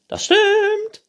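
very high, loud screech; princess. A narrator's reading. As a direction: confirm it at a shriek. Princess figure confirming. (hohe, laute Prinzessinnenstimme)

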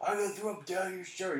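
put-on drunk taunt. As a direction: drunk guy pestering the girl. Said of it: "He's just like".

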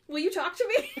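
high, loud mock desperation; laughing mock plea. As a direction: beg for company through laughter. Acting out their lonely teenage plea.

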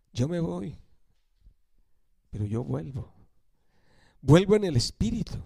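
a tender goodbye, voice dropped low; gentle farewell. Acted. Jesús se despide de sus discípulos. (despedida suave y tierna, en voz baja)

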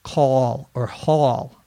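ordinary talk, nothing marked

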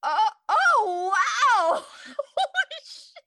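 high, loud laughing wow; stunned giggling awe; amazed laugh. Retold or imitated. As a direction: gasp it out in delighted shock. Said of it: "I was like".

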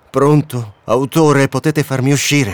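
loud calls upward to the author; calling out. Acted. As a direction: shout for the author to hear.

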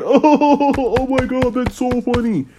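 mocking high-pitched gushing; mock shrill fake laugh reaction. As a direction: parody an overexcited fan squealing. Mocking feminists laughing at joke.

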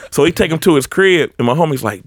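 ordinary talk

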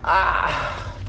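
ordinary talk

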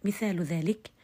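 ordinary talk

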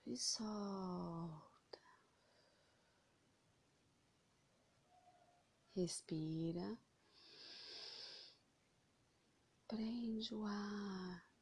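slow, soft meditation voice; slow breath counting. Acted. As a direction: guide each breath in and out, slowly. Guides breathing slowly.

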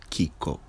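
KIKO as KEE-ko, weight on KEE; soft, low KIKO stressed at the front; stress on first syllable. Shown as a demonstration. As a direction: hit the first syllable of KIKO, let the second drop. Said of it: "First syllable".

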